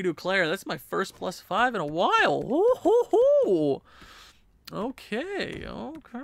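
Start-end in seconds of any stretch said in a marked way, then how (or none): 2.4-3.8 high, loud "hoo-hoo-hoo"; celebratory hooting. Real, not acted. First plus five in a while, celebratory high hooting. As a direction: whoop it out in bouncing hoots.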